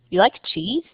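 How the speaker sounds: You like cheese with a rise on cheese; rising question. Shown as a demonstration. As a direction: ask it by tone alone. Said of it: "You can use intonation".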